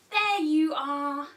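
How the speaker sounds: stretched, joyful there you are; drawn-out delighted reveal. Shown as a demonstration. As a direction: savour the reveal for toddlers. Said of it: "again, we're saying".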